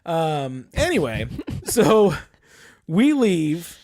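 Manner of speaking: usual speaking voice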